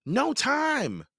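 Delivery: put-on boy brushing off his brother; urgent boy. A narrator's reading. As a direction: cut him off quickly.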